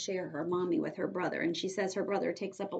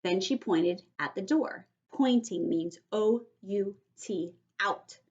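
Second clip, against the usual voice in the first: ordinary talk, nothing marked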